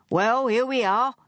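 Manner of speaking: high-pitched, pleased announcement; proud. A narrator's reading. Dave presenting McAllister Springs to Charles, proud. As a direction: show off the place with pride. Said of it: "said Dave proudly".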